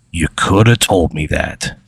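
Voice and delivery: lightly voiced glaring character, slow; a slow, understated reproach. Ray reproaches the player after a glare, glaring and slow. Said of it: "he just glares right through you".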